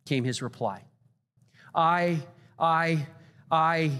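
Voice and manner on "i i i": halting repeated I; stuttering. Retold or imitated. Templeton stuttering while searching for words. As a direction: stumble on the first word. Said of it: "he stuttered".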